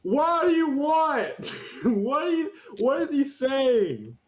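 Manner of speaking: high drawn-out bewildered voice; a high, dumbfounded orc; high, slow confused orc. Acted. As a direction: play the orc baffled and slow.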